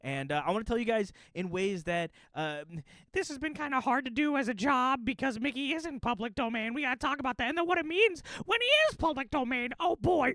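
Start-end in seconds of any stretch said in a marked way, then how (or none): 3.1-10.3 high, squeaky cartoon mouse voice; Mickey voice. Puppeteer chats to viewers as his character, Mickey voice.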